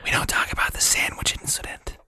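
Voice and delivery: hushed conspiratorial whisper. Acted. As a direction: whisper it like a secret. Joking conspiratorial asides.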